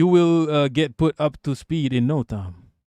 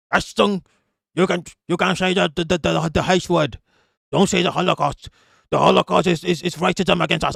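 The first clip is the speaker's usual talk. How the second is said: put-on German accent slipping into Israeli; mock a German official, accent drifting towards Israeli; attempted German accent, sounding Israeli; 2 semitones higher than usual. Streamer voicing a Nazi banning the word Holocaust, muddled German-Israeli accent. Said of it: "why am I doing an Israeli accent".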